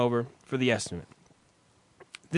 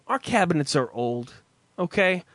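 usual speaking voice

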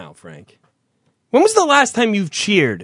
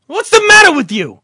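loud, high furious tirade; angry scolding; 6 semitones higher, 5 dB louder than usual. Acted. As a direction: chew him out for buying the car. Acted mob boss scolding.

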